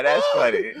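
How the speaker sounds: high, amused, through laughter; laughing. Real, not acted. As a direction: say it cracking up. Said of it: "repeats it laughing".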